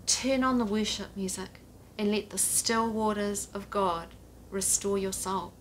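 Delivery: ordinary talk, nothing marked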